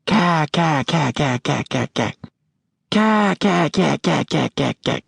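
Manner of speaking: high, rhythmic quacking caws like a duck call; duck call imitation. Dad calling ducks from the blind, high rhythmic duck-call caws. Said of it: "he changed the cadence of his calls".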